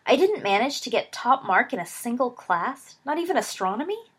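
rising disbelief at losing; dismayed disbelief. A narrator's reading. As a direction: Sirius outraged at missing every top mark. Sirius dismayed at results.

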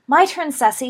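eager call to the sister on the broom. A narrator's reading. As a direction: call it across the snow. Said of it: "she called to her sister".

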